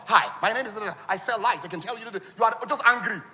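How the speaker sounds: lightly voiced ad guy; imitating the seller. Social media seller's pitch, lightly imitated. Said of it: "he will just cut".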